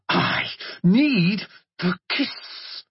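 very slow, strained hissing; hissed. Acted. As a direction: force it out under control. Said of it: "and hissed".

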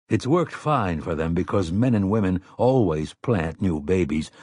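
usual speaking voice

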